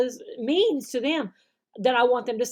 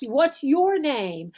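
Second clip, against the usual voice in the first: slow, clear model question; slow teacher question; 3 semitones higher, much slower than usual. Shown as a demonstration. Teacher modeling a question, slow and clear. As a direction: ask it slowly for the student. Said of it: "You might have to ask Dino".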